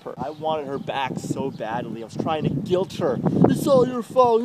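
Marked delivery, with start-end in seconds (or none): 3.5-4.4 louder, higher accusing blurt; mock blame. Imitating himself guilting his ex, mock accusing. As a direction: throw the blame at her, hammed-up.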